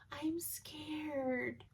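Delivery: put-on high, soft, timid little-girl voice; little scared voice. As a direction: whimper it like a frightened small child on the phone. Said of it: "in this little scared voice".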